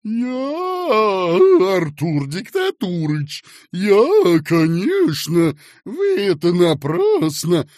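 high, loud, whining self-defence; flustered meek protest. A narrator's reading. Accused boss turning meek good fellow, flustered protest. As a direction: protest ingratiatingly, stretching the words. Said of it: "превращаясь ... в Дыркина добряка".

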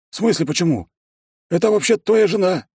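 high-pitched, indignant character voice; secretary's astonished voice. A narrator's reading. Secretary challenging his boss about the wife, high and astonished. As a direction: squeak it out, high and incredulous.

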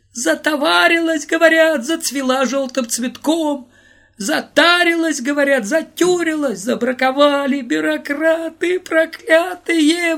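high, loud wailing lament; high sobbing shout. Acted. Volodya lamenting the rejected barrel to the crowd, high sobbing shout. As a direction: wail it out through sobs. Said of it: "высоким рыдающим голосом кричал Володя".

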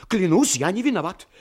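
pleading oath. Acted. Frightened Sinkright pleads innocence to the captain, desperate. Scared man swears innocence.

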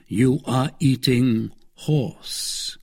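very slow, deliberate pronouncement; grave. A narrator's reading. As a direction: deliver the news gravely, word by word. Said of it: "answered Athos gravely".

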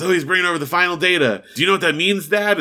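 high, fast, eager announcing; bursting to share big news; excited teen. Retold or imitated. Son excitedly announcing data arrival.

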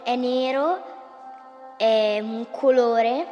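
ordinary talk

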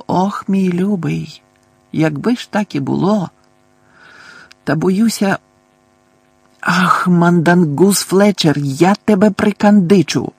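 put-on old woman's lament that hardens into anger; worried neighbour vowing revenge on Mundungus; fretting old lady turning fierce. A narrator's reading. Mrs Figg fretting then threatening.

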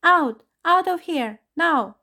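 high, loud frantic cries; hysterical whine. A narrator's reading. Raja urging everyone out, hysterical whining shouts. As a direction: shriek it in full panic. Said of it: "his voice rising to a hysterical whine".

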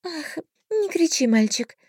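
high fairy voice, wincing. A narrator's reading. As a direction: scold the boy gently. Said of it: "поморщилась фея".

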